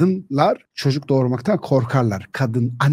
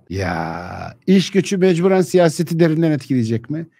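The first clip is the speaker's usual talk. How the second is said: usual speaking voice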